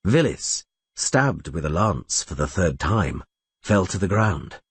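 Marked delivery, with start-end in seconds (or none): none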